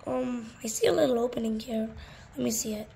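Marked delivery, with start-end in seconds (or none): none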